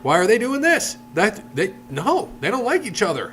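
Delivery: high-pitched mock disbelief; baffled outrage. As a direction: play a fan who can't believe it. Acted outrage of shocked fans.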